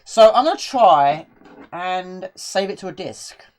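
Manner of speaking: ordinary talk, nothing marked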